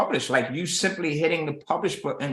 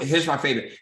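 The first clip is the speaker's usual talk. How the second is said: ordinary delivery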